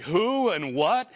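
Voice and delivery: high, slow, indignant mock retort; hammed-up angry owner, each word dragged out; imitating a pissed-off owner. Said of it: "the guy's pissed".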